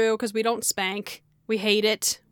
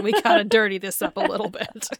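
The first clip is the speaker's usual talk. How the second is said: ordinary delivery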